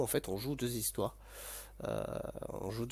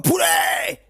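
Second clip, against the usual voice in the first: big high yell, much louder; excited shout; 11 dB louder than usual. Real, not acted. Streamer yelling out to chat, excited shout. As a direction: burst out shouting the word.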